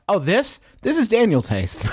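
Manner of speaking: high, laughing proud announcement. Retold or imitated. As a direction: announce it with a laugh, pleased with yourself. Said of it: "There's no way I'm not gonna call".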